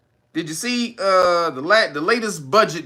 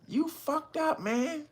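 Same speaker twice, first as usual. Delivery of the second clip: measured; 11 semitones higher, 10 dB quieter, slower than usual. Retold or imitated. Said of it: "was very measured".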